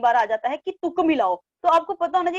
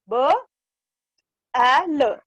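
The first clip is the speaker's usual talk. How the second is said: "ब... ए... ल" slowly, each sound apart; 3 dB louder, much slower than usual. Shown as a demonstration. Teacher showing the slower alternative reading, "ब, ए, ल" separated. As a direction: spell out "ब, ए, ल" with gaps. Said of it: "आप ऐसे भी बोल सकते हैं".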